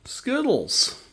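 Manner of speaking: high, slow cooing at a cat; pet talk voice. Real, not acted. Owner cooing at her cat.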